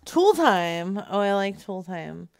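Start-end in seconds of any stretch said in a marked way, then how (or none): none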